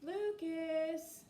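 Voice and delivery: calling out. Acted. Someone calls the boy.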